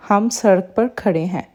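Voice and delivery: usual speaking voice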